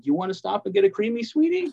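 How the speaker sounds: high-pitched, quick grandparent impression; imitating sweet grandparent. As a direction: coo it like a doting grandparent. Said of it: "grandparents go".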